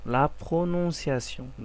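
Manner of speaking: slowly drawn out French phrase; teacher modelling pronunciation slowly; slow model phrase. Shown as a demonstration. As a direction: stretch it so learners can copy. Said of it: "মানে এটা হচ্ছে উচ্চারণ".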